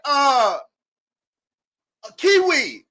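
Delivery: high, loud mock stumble over the name; mock manager mangling the name. Manager misreading his name tag at work, mock high stumble. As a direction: imitate the manager misreading [name] as Kiwi. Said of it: "she was like".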